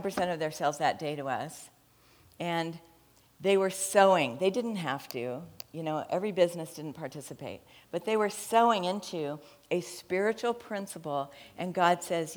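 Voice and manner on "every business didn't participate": ordinary talk, nothing marked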